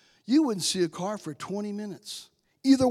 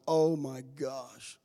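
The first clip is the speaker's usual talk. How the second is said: low, drawn-out sinking reaction; mild dismay; 7 semitones lower, 7 dB quieter, much slower than usual. Retold or imitated. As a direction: let it sink in slowly, softer and lower. Reacts to chief of police news.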